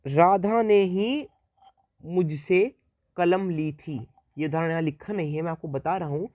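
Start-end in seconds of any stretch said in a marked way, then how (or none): 0.1-4.1 slow, emphatic Radha ने ही, drawn out with a pause before मुझसे; stress on Radha ने ही. Shown as a demonstration. Teacher slowly stresses the particle example for students, emphatic. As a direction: lean hard and slow on Radha ने ही. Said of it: "किसी और ने नहीं ली".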